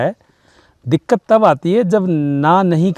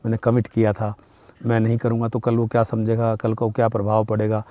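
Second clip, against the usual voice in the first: soft, low worried muttering to yourself; a hushed anxious inner monologue; worried self-talk; 6 semitones lower, 8 dB quieter than usual. Retold or imitated. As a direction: fret quietly under your breath.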